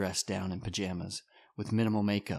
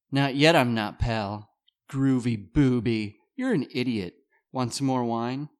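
playful woman voice; 4 semitones higher, 7 dB louder than usual. Acted. Maggie teasing Don.

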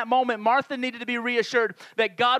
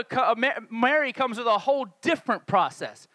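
ordinary delivery

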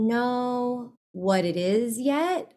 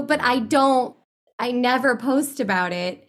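ordinary talk

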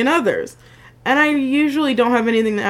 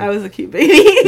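laughing boast; 5 semitones higher, 6 dB louder than usual. Real, not acted. Joking boast over laughter.

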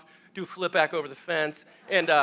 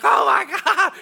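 loud, high comic scream; mock terror; 10 semitones higher, 7 dB louder than usual. Hired hand running from stampede, high mock scream. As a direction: squeal it as if fleeing for your life.

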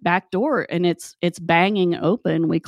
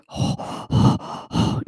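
imitated heavy breathing. Imitating Garvis walking in front, heavy breathing. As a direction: mimic someone breathing heavily. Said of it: "he goes back to breathing".

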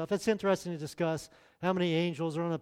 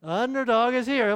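louder, higher cartoon hero announcement; Underdog catchphrase; 5 semitones higher, 5 dB louder than usual. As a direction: boom it like the cartoon superhero. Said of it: "What did he used to say when he showed up?".

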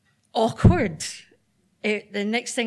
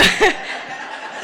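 laugh; 10 semitones higher, 4 dB louder than usual. Real, not acted. Nervous laugh on stage.

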